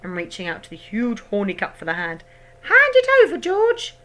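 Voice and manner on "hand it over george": very high, loud hag shout. A narrator's reading. Grandma shouting at George, very high and loud. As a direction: bark at the boy.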